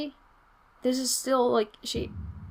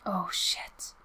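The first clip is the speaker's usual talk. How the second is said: whisper; 9 dB quieter than usual. Real, not acted. Whispered reaction to game.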